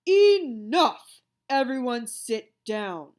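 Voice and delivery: loud, booming teacher roar, slow at the end; teacher roaring to stop the teasing. A narrator's reading. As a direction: roar to silence the class. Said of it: "Mister Jupiter roared".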